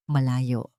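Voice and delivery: plain reading voice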